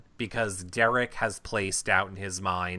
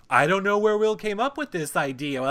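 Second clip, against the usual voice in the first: higher, louder mimicry of a complaint; mock innocent protest, pitched up; mocking imitation; 9 semitones higher, 5 dB louder than usual. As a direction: parrot someone's protest in a raised pitch. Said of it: "Paris was over there going".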